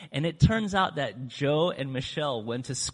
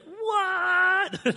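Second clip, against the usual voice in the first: very high squeal of disbelief; shocked what; 5 dB louder than usual. Retold or imitated. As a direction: gasp it out in total surprise. Michelle's shocked reply.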